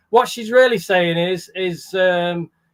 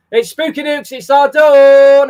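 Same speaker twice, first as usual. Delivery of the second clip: much louder, slow, stretched-out welcome; excited greeting; 6 semitones higher, 12 dB louder than usual. Real, not acted. Host calls out a greeting to a viewer in chat, stretched and loud.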